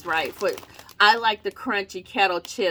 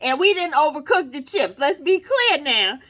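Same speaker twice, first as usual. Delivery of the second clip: mock-insistent company spokesman, pitched higher; hammed-up corporate denial; making it clear; 6 semitones higher than usual. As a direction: firmly deny it on the company's behalf. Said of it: "they wanted to make that clear".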